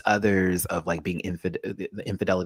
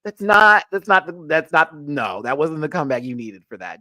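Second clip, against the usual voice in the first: exasperated outburst; 5 semitones higher, 5 dB louder than usual. Retold or imitated. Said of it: "I was like".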